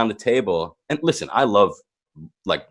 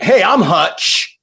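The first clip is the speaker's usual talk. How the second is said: slow, higher, louder swaggering hello; cocky Hutch intro; 8 semitones higher, 5 dB louder, much slower than usual. Retold or imitated. Arriving at a party with two girls, slow cocky intro. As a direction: strut in announcing yourself.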